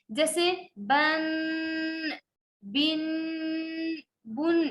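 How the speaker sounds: بن with the nasal held long; nasal ghunna. Shown as a demonstration. Teacher demonstrates ghunna: nasal بن. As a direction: hold the n of بن in the nose. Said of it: "ناک میں آواز کو روکنا غنہ".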